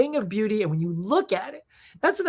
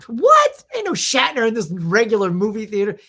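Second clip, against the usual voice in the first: can't believe Shatner's here; shocked disbelief; 2 semitones higher, 6 dB louder than usual. Retold or imitated. Disbelief at friend's claim.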